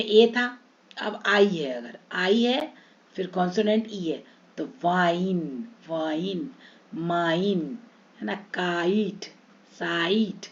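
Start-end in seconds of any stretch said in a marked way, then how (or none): 4.8-7.8 long gliding "eye" vowel, each word falling; stretched long i. Shown as a demonstration. Teacher modelling silent-e words, long i stretched. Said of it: "आई को हम खींचेंगे". 8.5-10.5 long gliding "eye" vowel before a final t; stretched long i. Shown as a demonstration. Teacher modelling silent-e words, long i stretched. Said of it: "आई को हम खींचेंगे".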